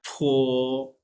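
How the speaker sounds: फो with a strong release of breath, then held; aspirated initial. Shown as a demonstration. Teacher showing how the voice is thrown, aspirated demo. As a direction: push air out at the start of फो. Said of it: "उसको हम ज़्यादा release करते हैं ना".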